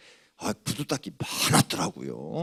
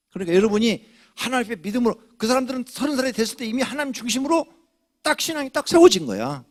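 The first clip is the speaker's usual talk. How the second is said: high, quick, rising sermon push; fast climbing preaching; 11 semitones higher, 5 dB louder than usual. Acted. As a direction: drive it home faster and higher. Pastor preaching.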